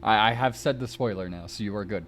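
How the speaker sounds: ordinary delivery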